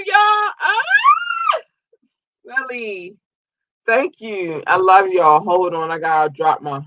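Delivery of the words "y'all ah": excited squeal. Real, not acted.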